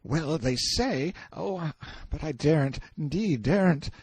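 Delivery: high, hesitant and frightened; fearful boy. A narrator's reading. Boy dares not tell the prisoner, fearful. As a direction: falter as if afraid to tell.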